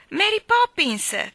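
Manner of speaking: high, drawn-out, loud call. A narrator's reading. Jane calling Mary Poppins over, loud excited call. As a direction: summon someone from another room. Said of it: "chiamò Jane".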